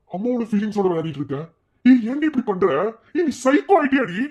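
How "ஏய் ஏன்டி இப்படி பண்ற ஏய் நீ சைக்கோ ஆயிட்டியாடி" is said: husband rages at his scheming wife; angry shout. Acted. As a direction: shout at her in disgust. Husband confronts cruel wife.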